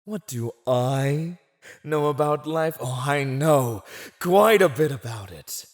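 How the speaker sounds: loud, high, theatrical retort; mock-incredulous retort. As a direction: throw the question back, then boast. Villain retorts to captive.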